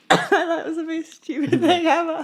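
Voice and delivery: laughing through the words. Real, not acted. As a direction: giggle while speaking. Laughing at ice cube.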